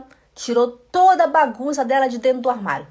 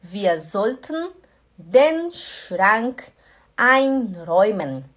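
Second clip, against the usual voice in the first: slow, clear German sentence; careful slow teaching delivery; slow demo; much slower than usual. Shown as a demonstration. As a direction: spell it out slowly for students. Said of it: "Also, Schatz".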